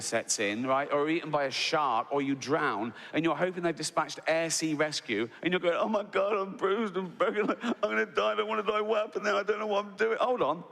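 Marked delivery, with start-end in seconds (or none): 5.8-10.6 high panicky babble; hammed-up panic, rushing; panicked. Panicking survivor in the water.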